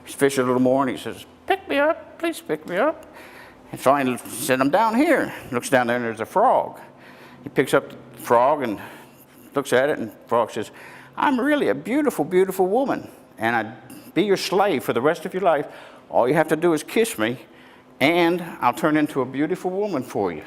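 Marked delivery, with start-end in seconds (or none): 1.5-3.1 tiny frog voice. Acted. Frog calling again. 4.6-5.3 high little frog calling up. Acted. Frog speaks. 11.2-20.4 higher sweet-talking frog. Acted. Frog pleads to fisherman.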